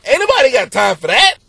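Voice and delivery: loud, high viral lady voice; viral lady impression. Comic repeating the viral catchphrase, loud high impression. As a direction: land it as the punchline.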